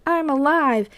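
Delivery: high, singsong surprise announcement; Natasha's mock surprise reveal. Spy revealing herself to Yelena, high mock surprise. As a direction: pitch it up like a playful ta-da.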